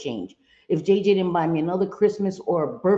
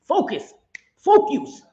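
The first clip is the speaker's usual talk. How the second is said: high, loud mock nudge; Vera nudging her; 6 semitones higher, 5 dB louder, slower than usual. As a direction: imitate a friend snapping her back to focus. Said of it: "Vera be like".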